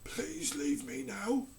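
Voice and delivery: slow, soft, far-off request; dazed king. A narrator's reading. King to the Lord Protector, dazed and soft. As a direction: speak as if staring into space. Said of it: "said the King".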